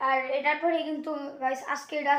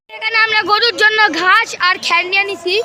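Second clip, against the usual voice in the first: excited loud; 6 semitones higher, 10 dB louder than usual. Real, not acted. Excited report at the market.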